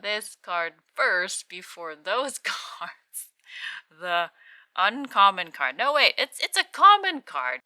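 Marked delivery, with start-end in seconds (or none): 5.8-7.6 excited. Real, not acted. Correcting himself excitedly.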